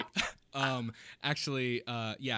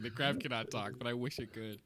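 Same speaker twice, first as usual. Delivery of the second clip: ordinary talk, nothing marked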